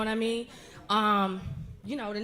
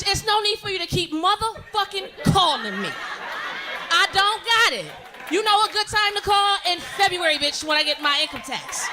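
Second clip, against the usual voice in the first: mock cursing out; 4 semitones higher than usual. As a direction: yell at the collector to stop calling. Cursing out bill collector.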